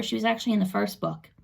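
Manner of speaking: ordinary delivery, nothing marked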